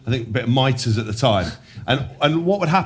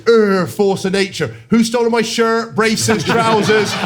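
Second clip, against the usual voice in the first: slow, higher, louder drawl of "force of nature"; mocking teammate drone; 9 semitones higher, 5 dB louder, slower than usual. Teammate taunting him on the flight, slow higher drone. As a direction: stretch it out like a lazy taunt. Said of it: "you just hear this".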